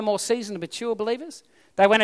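ordinary talk, nothing marked

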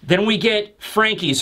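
plain reading voice

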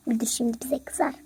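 hushed worried whisper. Acted. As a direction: whisper so the principal won't hear. Hiding from principal, whispering. (fısıltıyla, endişeli)

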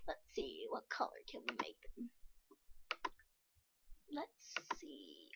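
quick soft whisper. Real, not acted. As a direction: whisper the question fast to yourself. Kid whispering while designing face.

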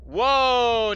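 loud high delighted gasp; excited whoa. Real, not acted. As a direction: burst out with amazement. Made it through fans.

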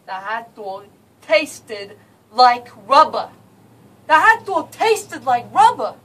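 put-on loud, drawn-out outrage in a Jersey accent; hammed-up New Jersey indignation; aggravated rant. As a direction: punch out each word, disgusted New Yorker.

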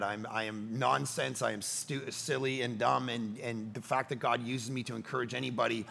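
soft, low reflective confiding; subdued, humbled. Real, not acted. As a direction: drop low and quiet, humbly moved. Recounts dying man's wish, humbled.

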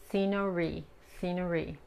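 "scenery" with rounded lips and the tongue mid-mouth; English R demo. Shown as a demonstration. Teacher repeating "scenery" for students, clear English R. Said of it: "formar un círculo con los labios".

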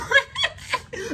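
high burst of laughter. Real, not acted. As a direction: crack up at the story. Laughing at the spraying story.

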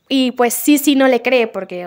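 ordinary talk, nothing marked

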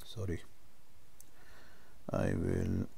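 ordinary delivery

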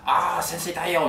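put-on high, slow whining old man; old man in pain. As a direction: whimper like an old patient in pain. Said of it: "おじいちゃん痛い".

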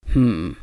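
low, grudging closed-mouth hmm; a reluctant low hum through closed lips; mumbled hmm. A narrator's reading. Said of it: "he mumbled".

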